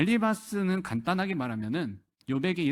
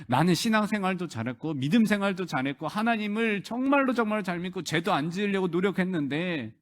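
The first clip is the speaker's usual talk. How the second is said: higher-pitched, piled-up complaint to God; aggrieved protest; 5 semitones higher than usual. Retold or imitated. Job comparing himself with the wicked before God, protesting. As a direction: argue your case as the wronged Job. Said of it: "욥이 따지는 모습".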